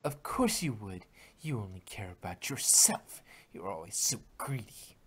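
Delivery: low, quiet, sneering; cold contempt. Acted. Twilight coldly accusing Rarity, low and quiet. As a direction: hiss it down at her.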